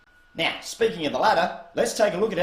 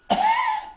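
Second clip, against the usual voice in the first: choking cough; 5 dB louder than usual. Acted. Choking mid-message.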